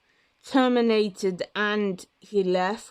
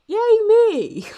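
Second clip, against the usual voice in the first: cheering; 14 semitones higher, 8 dB louder than usual. Real, not acted. Self-cheer, sarcastic.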